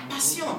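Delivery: "Passion" said the French way, pah-syon; French pronunciation. Shown as a demonstration. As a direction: say "Passion" with an s-y sound, no sh. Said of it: "What is passionate in French?".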